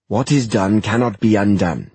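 grave, solemn declaration; Will voice. Acted. Will's voice.

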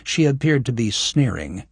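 plain reading voice

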